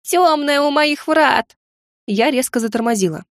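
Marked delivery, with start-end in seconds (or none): 0.0-1.6 slow, high, drawn-out wail; plaintive gargoyle wail. Acted. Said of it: "прервало жалобное".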